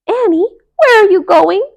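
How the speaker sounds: high, loud call across the room. A narrator's reading. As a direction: call after her friend. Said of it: "called Melissa".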